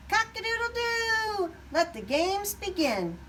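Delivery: high, ringing rooster crow. A narrator's reading. Rooster waking the farm, high crowing call. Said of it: "Rooster crowed".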